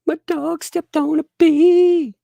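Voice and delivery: very high, slow wailing voice; mimicking a tearful whine, high and loud; mock sobbing whine. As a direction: wail it like a crying child. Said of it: "Let me sing that to you".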